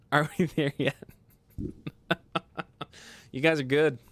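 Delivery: ordinary delivery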